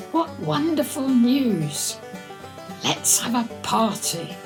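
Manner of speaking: usual speaking voice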